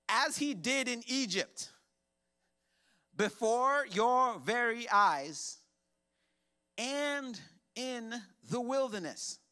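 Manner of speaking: high, slow, drawn-out reading; slow scripture reading. Acted. Pastor reads Moses' words to the church, slow and lifted. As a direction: stretch each phrase out.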